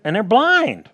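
ordinary talk, nothing marked

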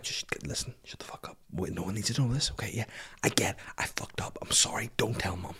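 hushed, urgent whispered bargaining; secretive whisper. Retold or imitated. Kid who kicked a younger sibling begging silence, whispering. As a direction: whisper it so mum won't hear.

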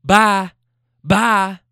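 retold angry goodbye; imitating her dismissal. Girlfriend sending her boyfriend off, mock angry bye. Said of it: "She's like".

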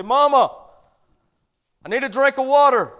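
put-on high, loud little boy calling Mama; child calling out. Little boy calls from upstairs to his mama, high and loud. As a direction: call up the stairs like a scared little boy. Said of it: "he'd call out to his mama".

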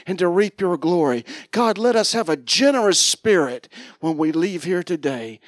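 loud preaching prayer building in force; fervent prayer. Acted. Pastor praying loudly over the congregation, fervent. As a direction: pray it out loud over the room.

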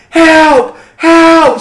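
loud, high re-enacted distress call; long, high cry for help; screaming for help. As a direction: holler to a neighbor from under a door.

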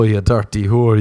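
normal talking voice

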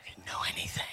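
whispered confession. Real, not acted. Comic confiding to the audience in a whisper. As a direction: breathe it out as a whisper.

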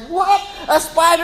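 high, loud mock alarm; Isaiah. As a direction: imitate a kid freaking out in disbelief. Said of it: "then Isaiah's like".

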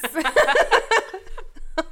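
high, loud burst of laughter. Real, not acted. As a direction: burst out in a long cackling laugh.

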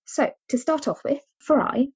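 ordinary talk, nothing marked

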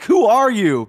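high, loud imitation of a baffled questioner; hammed-up incredulous question; mock challenge. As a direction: act out someone demanding an identity. Retelling people confronting the action hero.

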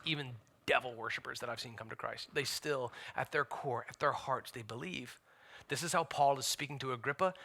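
quiet, confidential pulpit voice; hushed preaching. Real, not acted. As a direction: drop right down for a grave, intimate appeal. Preacher drops to hushed aside.

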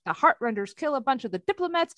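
ordinary talk, nothing marked

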